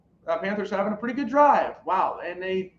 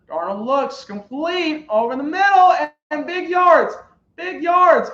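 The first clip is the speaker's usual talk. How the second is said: climbing higher and louder with each phrase; building excitement; 8 semitones higher, 8 dB louder than usual. Real, not acted. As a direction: let the call swell as the play breaks open. Live call of big completion.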